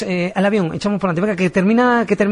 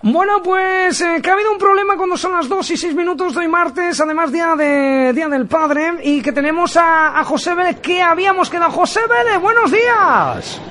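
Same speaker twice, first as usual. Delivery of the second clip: bright, raised on-air host voice; radio announcer; 9 semitones higher than usual. Acted. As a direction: sell it like a live radio host.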